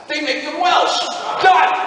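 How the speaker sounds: excited shout. Retold or imitated. Voice actor recalling the casting choice, excited shout. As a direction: shout the answer like a eureka. Triumphant punchline about Doctor Who.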